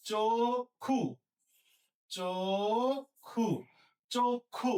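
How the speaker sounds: চক্ষু stretched as "chok-khu"; closed o vowel. Shown as a demonstration. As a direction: hold the rounded o of চক্ষু before khu. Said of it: "তাহলে হবে কি".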